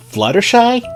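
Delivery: high, loud squeak shooting upward; startled squeak. A narrator's reading. Shy pony caught in a back alley, squeaking. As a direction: let the name leap up in a squeak. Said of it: "squeaked Fluttershy".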